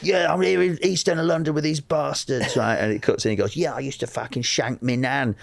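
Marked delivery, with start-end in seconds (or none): 0.0-2.6 higher-pitched Cockney hard-man impression; mock tough East End TV presenter; Danny Dyer. Imitating an actor fronting a hard-men documentary, Cockney swagger. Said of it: "like, Danny Dyer, Danny Dyer's Hard Men". 3.6-5.3 mock Cockney hard man; Danny Dyer. Imitating an actor playing a football hooligan, Cockney. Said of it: "he was doing, like, Danny Dyer".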